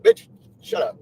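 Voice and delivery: loud curt retort; snapped insult. Retold or imitated. Influencer quoting her reply to a hater, loud and curt. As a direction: bark it back to shut her up. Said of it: "So I was like".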